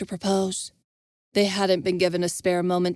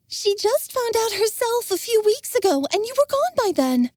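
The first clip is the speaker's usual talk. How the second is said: put-on much higher young woman, insistent; insisting. Jenna defending Dylan to Envis, insistent. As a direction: press the point for Dylan. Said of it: "Jenna insisted".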